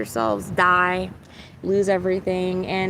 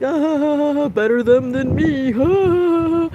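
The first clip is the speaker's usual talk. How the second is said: higher, louder hammed-up scoff; mocking smug voice; 7 semitones higher, 7 dB louder, much slower than usual. Mocking people laughing at others' disasters, hammed-up scoffing voice. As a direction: sneer it like someone gloating from safety.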